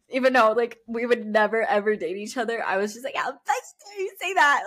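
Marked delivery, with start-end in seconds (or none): 3.2-4.6 high-pitched indignant replay; mock-furious, voice shooting up; retold outrage. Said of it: "And I was so mad".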